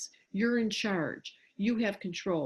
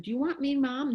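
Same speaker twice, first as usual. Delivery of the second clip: higher-pitched mock threat to a whining child; warning question; 5 semitones higher than usual.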